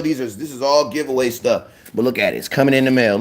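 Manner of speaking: ordinary talk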